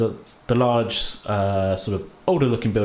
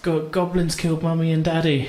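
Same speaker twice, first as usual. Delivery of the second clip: lightly voiced small girl, stammering; a child blurting out bad news; mumbling little girl; 7 semitones higher than usual. Girl to her uncle, a lightly voiced stammer. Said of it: "She says".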